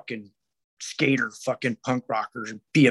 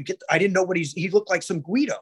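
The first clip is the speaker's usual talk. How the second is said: ordinary talk, nothing marked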